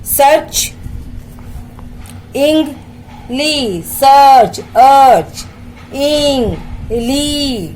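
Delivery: Search, इंग, ली as slow separate beats; three-part breakdown with held vowels; syllable-by-syllable. Shown as a demonstration. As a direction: stretch search, pause, draw out इंगली. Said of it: "three syllables के words".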